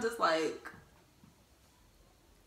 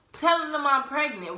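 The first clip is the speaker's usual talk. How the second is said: usual speaking voice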